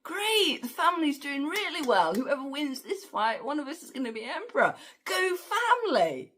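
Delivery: very high, chirpy sarcastic cheer; cheery mock family pride. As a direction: cheer on the family like a giddy fan. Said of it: "that was kind of like".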